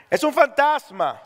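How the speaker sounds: high, drawn-out cry of alarm; frightened cry. Acted. Preacher voicing disciples who see a ghost, frightened cry. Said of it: "de miedo se pusieron a gritar".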